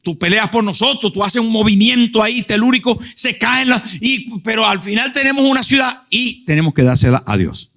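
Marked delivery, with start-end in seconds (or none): 1.2-7.7 high, fervent preacher's cry; impassioned preaching. Acted. Preacher to the congregation, crying out in fervour.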